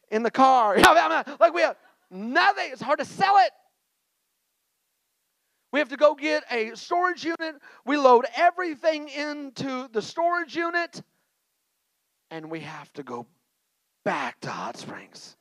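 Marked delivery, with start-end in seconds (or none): 0.1-11.0 loud, raised preaching voice building up; preaching at full force. Acted. Preacher stirring the church with his moving story, loud and raised. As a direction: preach it to the congregation.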